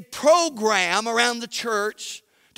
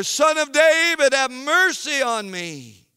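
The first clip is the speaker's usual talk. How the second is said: crying out insistently; 6 semitones higher, 5 dB louder than usual. A narrator's reading. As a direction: keep calling over the crowd, begging. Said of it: "he kept crying out all the more".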